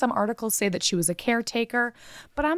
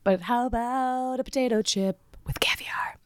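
whisper. Pretend. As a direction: whisper it slyly. Whispered joke.

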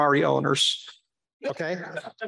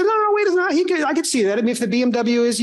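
excited objection; 7 semitones higher than usual. Retold or imitated.

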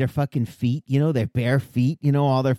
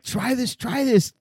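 high, hushed pushy voice; mock eager urging; 8 semitones higher, slower than usual. Berry eater pushing friends to try it, mock eager urging. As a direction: urge it in a high put-on voice.

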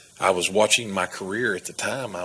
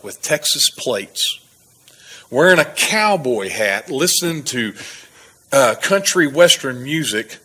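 lecturing cop; 4 semitones higher, 5 dB louder than usual. Retold or imitated. Officer lecturing him, retold.

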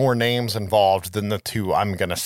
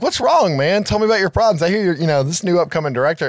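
higher, louder, overly friendly chatter; eager chatty stranger; 7 semitones higher, 4 dB louder, faster than usual. Retold or imitated. Actor chatting up a gloomy director at a party, high and loud. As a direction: play the pushy party guest who won't stop talking. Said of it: "And he's like".

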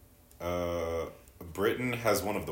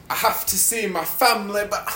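much higher and louder, squealing in mock despair; mock whiny Brit; 13 dB louder than usual. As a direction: whine it like an overdramatic Brit. Mocking Brits, high squeal.

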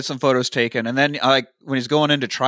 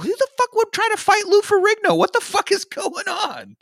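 very high-pitched, squealing disbelief; mock incredulous shriek. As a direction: act out an aghast squeal, stretching the end. Voices caller's disbelief at the fight.

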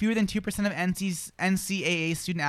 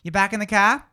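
louder, higher New England r-dropping accent; Eastern New England accent; 4 semitones higher, 5 dB louder than usual. Shown as a demonstration. Host demonstrates an Eastern New England accent. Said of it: "Eastern New England".

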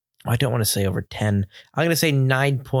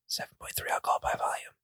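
whispering a secret; 12 dB quieter than usual. Pretend. Whispering the strong ABV.

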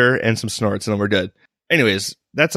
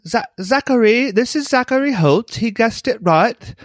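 high, hammed-up fake British accent; horrible British accent; 10 semitones higher than usual. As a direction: overdo a silly posh British accent. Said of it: "say his name in British accents".

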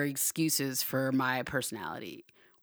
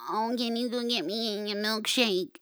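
lightly acted, higher-pitched asking; mock wifely request; 7 semitones higher than usual. Wife asking husband for a treat, lightly put-on pleading. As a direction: put on a high little voice to request a favor. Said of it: "you can be like".

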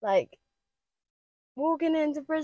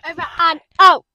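sign-off call; 6 semitones higher, 16 dB louder, faster than usual. Pretend. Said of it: "sign-off".

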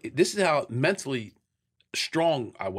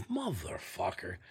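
drawn-out, lower, much softer muttered motherfucker; low dismayed curse; 6 semitones lower, 11 dB quieter, slower than usual. Retold or imitated. Driving past thirty cops, a slow, soft, low dread curse. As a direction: breathe it out slow under your breath like a man who knows he's caught. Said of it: "I'm like".

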